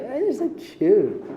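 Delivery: slow, high, cooing tenderness; fond doting voice. Pretend. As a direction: coo it like to a toddler. Hashem fondly forgiving a mistake.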